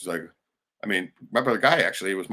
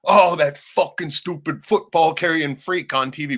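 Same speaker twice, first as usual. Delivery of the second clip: louder, higher, slow grumbling at the TV; mock angry rant; 9 semitones higher, 9 dB louder, slower than usual. Imitating the brother cursing the football game. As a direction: spit the insult at the screen. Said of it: "he's like".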